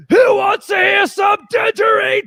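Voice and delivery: high, loud stage shout; rock singer hype call. Retold or imitated. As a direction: yell it to a festival crowd. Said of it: "your singer going".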